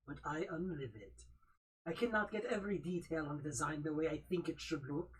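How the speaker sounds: hammed-up French accent, reading the bio; a vain French perfectionist gargoyle; mock French accent throughout; Scaris French accent. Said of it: "the incredible Slimy Jade Scaris accent".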